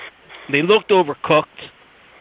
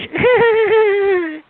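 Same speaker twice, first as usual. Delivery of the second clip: mock giggle; 3 dB louder than usual. Giggle ending girlfriend impression.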